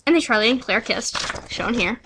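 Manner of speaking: ordinary delivery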